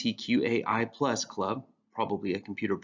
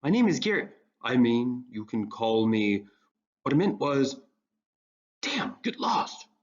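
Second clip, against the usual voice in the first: flustered, faltering tough act; flustered goth; 3 semitones higher than usual. Acted. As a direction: lose the cool mid-sentence.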